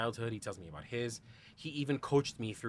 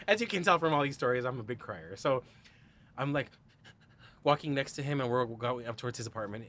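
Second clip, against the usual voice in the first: normal talking voice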